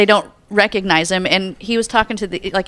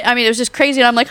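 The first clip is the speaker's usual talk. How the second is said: ordinary talk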